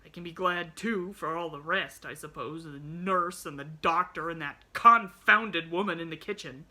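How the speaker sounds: loud, bitter sarcasm building to "confounded". A narrator's reading. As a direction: list them bitterly, leaning on each one. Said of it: "all the old bitterness coming back".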